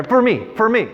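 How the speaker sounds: high, coaxing plea of a hawker; pushy street artist. Retold or imitated. Street artist seating a tourist for a drawing, pushy plea. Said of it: "they're trying to get me".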